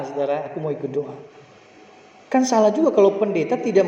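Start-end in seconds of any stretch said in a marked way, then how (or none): 0.5-1.1 put-on quieter, meeker childish plea; softer pleading child. Father repeating his son's plea, softer and more pitiful. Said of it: "Dia memelas lagi".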